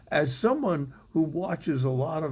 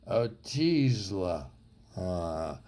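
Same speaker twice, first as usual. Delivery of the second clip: put-on slow, slurred drunken word; 6 semitones lower, 3 dB quieter, slower than usual. Comedian showing a medicine name said drunkenly, slow drunken slur. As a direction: drag it out like a drunk. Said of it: "say it drunkenly".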